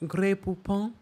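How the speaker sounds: airy mock-posh Grey Poupon; a hint of snooty new money. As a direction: put on a fancy rich-lady voice. Mocking Lisa's posh act.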